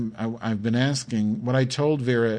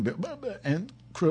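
excited stammer; 11 semitones higher than usual. Real, not acted. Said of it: "I'm getting excited".

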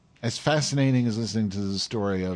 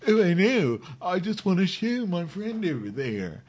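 stoner friend; 10 semitones higher than usual. Retold or imitated. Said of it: "he's like".

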